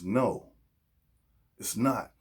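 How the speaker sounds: usual speaking voice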